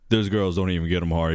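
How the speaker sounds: ordinary talk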